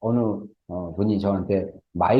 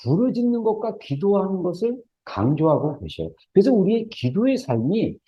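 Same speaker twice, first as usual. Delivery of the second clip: high-pitched, sustained preacher's exhortation; impassioned preaching; 10 semitones higher than usual. Real, not acted. Preacher urging congregation to cry out to God, impassioned preaching.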